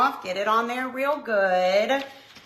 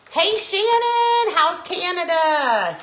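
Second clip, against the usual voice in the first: cheery greeting; 9 semitones higher, 7 dB louder, slower than usual. Real, not acted. Calling greeting to viewer.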